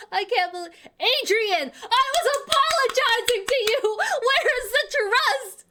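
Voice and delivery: high, loud plea of betrayal; wounded outcry. Real, not acted. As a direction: call her out, hurt and loud. Addressing the betraying character.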